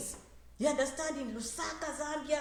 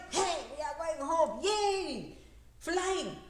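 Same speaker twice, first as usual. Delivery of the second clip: cheering home; 4 semitones higher, much slower than usual. Pretend. Immigrants cheering going home.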